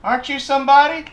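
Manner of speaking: loud, high, nosy stranger; high-pitched imitation of a pushy woman; woman on the street. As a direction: call out as a woman who half-recognizes someone. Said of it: "this woman goes".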